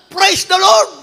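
a believer's full-voiced cry at the door; shouted praise. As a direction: bellow it out at dawn. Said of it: "sumigaw yung kapatiran".

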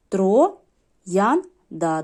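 «троянда» по складах, з паузами між «тро», «ян», «да». Shown as a demonstration. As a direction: split «троянда» into three separate syllables. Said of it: "три на, три склади". (вимовити «троянда» повільно, розділяючи три склади)

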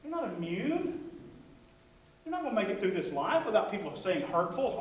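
usual speaking voice

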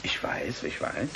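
hushed soothing; whispered. Acted. Scientist hushes the patient wanting to end it, whispered. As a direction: whisper it to him, gently.

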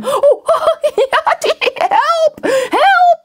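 very high, helpless tickled yelps; yelping for help. Acted. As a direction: squeal while being tickled. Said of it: "he yelped".

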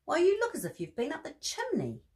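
an amused mother exclaiming; Mrs Bear's voice. Acted. Mrs Bear voiced in story.

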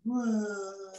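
short high cartoon "wah" cry; mock cartoon wail. Shown as a demonstration. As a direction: fake a baby wail for the drawing. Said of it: "Notice I really do make sounds".

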